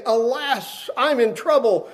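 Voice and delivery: high, urgent cry of alarm; alarmed lament. Retold or imitated. Preacher acting Isaiah's alarm to the church, high urgent cry. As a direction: raise it up like a man caught in sin.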